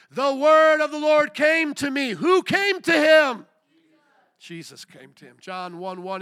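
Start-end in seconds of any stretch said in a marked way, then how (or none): 0.1-3.5 high, booming scripture shout; full-force pulpit yell; preacher's shouted challenge. Real, not acted. 4.4-5.4 low, soft reply after shouting; quiet, low answer; subdued answer. Real, not acted. Answers his own question.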